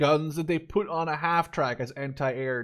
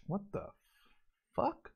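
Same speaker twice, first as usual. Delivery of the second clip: baffled surprise; 2 semitones higher, 11 dB quieter than usual. Real, not acted. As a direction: pitch jumps up on the last word in disbelief. Shocked by a weird unit.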